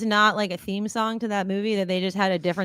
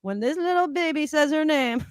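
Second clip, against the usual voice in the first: 1 DJ voice; 5 semitones higher, 4 dB louder than usual. Retold or imitated. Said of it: "He's like".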